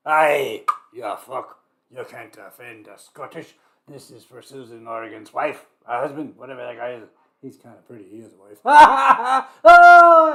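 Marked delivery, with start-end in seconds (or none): 0.1-3.6 loud mock Scottish "Aye"; Scottish accent. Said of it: "let's do a Scottish accent". 8.6-10.3 high, loud burst of laughter. Real, not acted. Cracking up after teasing Hayes.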